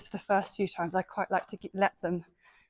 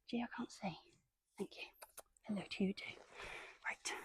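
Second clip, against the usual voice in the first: whispering; 7 dB quieter than usual. Real, not acted. Quiet asides near the pony.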